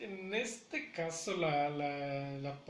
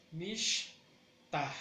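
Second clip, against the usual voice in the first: "mishta" as mish, pause, ta; slow teaching model in two beats; syllable by syllable. Shown as a demonstration. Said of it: "le damos más fuerza".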